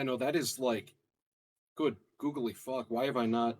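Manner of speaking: ordinary delivery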